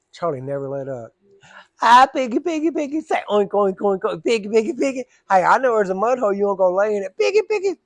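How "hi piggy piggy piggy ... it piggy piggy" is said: higher, louder singsong teasing; high mocking baby talk. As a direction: keep needling her with piggy calls. Charlie keeps teasing.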